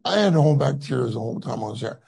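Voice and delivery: ordinary delivery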